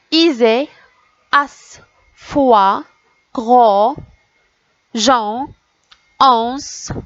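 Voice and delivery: drawn-out syllables with pauses between; syllable drill. Shown as a demonstration. Teacher reading the next row to children, measured and separated. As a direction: deliver each syllable as its own firm, held unit. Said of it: "on va lire les syllabes maintenant".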